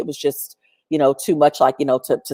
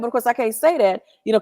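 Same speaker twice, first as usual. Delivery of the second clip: ordinary delivery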